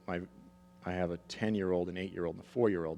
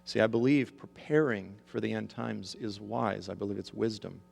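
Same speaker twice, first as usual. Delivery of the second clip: ordinary talk, nothing marked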